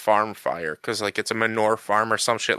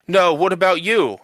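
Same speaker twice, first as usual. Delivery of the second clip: higher, louder mock demand; imitated refusal; 7 semitones higher, 5 dB louder than usual. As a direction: imitate a woman turning down one helper for another. Retold woman rejecting one helper.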